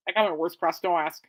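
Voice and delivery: usual speaking voice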